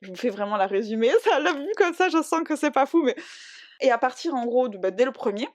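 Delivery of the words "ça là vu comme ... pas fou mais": laughing. Real, not acted. Laughs at own summary.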